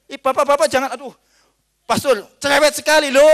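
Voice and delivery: loud, high exclamation of complaint; mock protest. Mimics people objecting to the pastor. (protes keras dengan nada tinggi)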